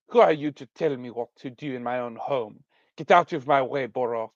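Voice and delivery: put-on indignant terrible French accent; put-on French accent, defiant; Aline. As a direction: brush him off in a hammy French accent. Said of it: "give her a really terrible French accent".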